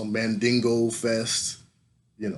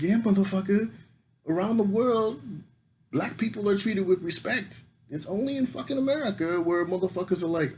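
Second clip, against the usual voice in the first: much higher, swaggering proclamation; triumphant; 10 semitones higher than usual. Retold or imitated. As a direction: crow it like you won. Triumphant answer to colleague.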